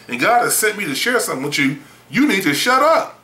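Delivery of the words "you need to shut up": slow, high-pitched, emphatic telling-off; harsh, blunt. Retold or imitated. Preacher telling a talkative listener off, harsh and blunt. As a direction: lay it down bluntly, pitch jumping up. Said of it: "that may sound harsh and rude and crude".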